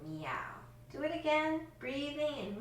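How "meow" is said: a short playful meow like a kitten; cat meow. Shown as a demonstration. Teacher leading children in cat-cow pose, quick meow. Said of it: "and let's meow for cat".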